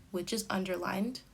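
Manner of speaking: usual speaking voice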